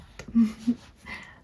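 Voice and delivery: small whispery laugh; hushed giggle. Acted. Witch amused by her own passionate speech, hushed laugh. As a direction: laugh lightly under the breath.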